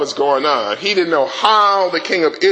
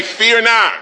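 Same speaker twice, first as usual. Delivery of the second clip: loud call; 6 semitones higher than usual. Shown as a demonstration.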